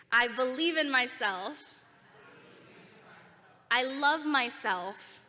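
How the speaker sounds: slow confident shout; slow loud affirmation. Shown as a demonstration. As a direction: let it ring out for the crowd to repeat. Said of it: "your loudest, most confident voice".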